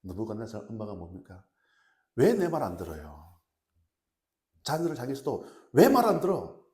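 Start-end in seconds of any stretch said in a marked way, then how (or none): 2.1-3.4 loud mock scolding, falling away; angry mother. Preacher mimics a Nebuchadnezzar-like mom scolding, angrily. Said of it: "느부갓네살 엄마들도 많아요". 5.7-6.6 loud, high outburst of scolding; angry mother snapping. Acted. Preacher mimics mom losing her temper at her children, angrily. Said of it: "버럭버럭 막".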